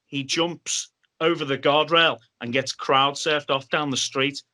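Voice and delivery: ordinary delivery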